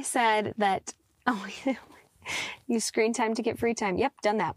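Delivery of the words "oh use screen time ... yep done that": ordinary talk, nothing marked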